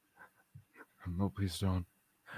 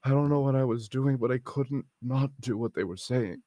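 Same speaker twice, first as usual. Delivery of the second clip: shaken distress; 6 semitones higher than usual. Acted. Character recalls being controlled.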